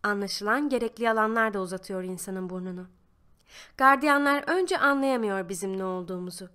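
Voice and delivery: usual speaking voice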